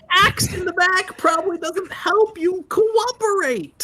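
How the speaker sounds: exasperated. Acted. Heated argument in character.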